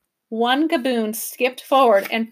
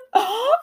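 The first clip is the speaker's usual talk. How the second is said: mock gasp; 12 semitones higher than usual. A narrator's reading.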